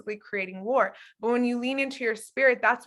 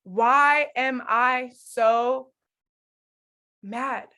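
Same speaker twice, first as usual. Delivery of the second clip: slow, drawn-out question to God; slow honest prayer; 4 dB louder, much slower than usual. Shown as a demonstration. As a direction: let each word hang as you ask why you're so mad. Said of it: "Go into your prayer closet and say".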